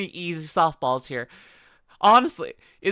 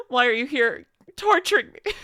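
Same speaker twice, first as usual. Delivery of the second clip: mock whine; 7 semitones higher, 5 dB louder than usual. Mock protest at chat.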